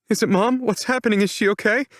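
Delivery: put-on high, frantic young woman's voice; panicked. A narrator's reading. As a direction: push the questions out in panic. Panicked daughter at door.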